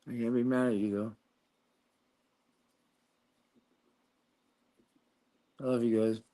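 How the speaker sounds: anger melting away. Real, not acted. Softens after yelling at viewers.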